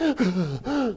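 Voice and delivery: imitated high sobbing gasp; gasping for air. Imitating the patient crying from her gut, gasping. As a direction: mimic a gut cry, sucking in air. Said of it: "gasping for air and she can't breathe".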